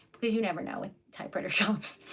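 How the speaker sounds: fast, hushed aside; conspiratorial whisper about typewriter shops. Real, not acted. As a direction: drop to a secret whisper. Jokey aside.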